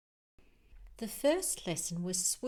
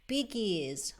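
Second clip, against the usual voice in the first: ordinary delivery, nothing marked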